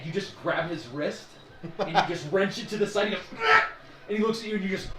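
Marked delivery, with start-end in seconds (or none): 3.4-3.7 high sharp cry of pain; pained yelp. Acted. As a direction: yelp as the wrist is wrenched. NPC cries out in pain.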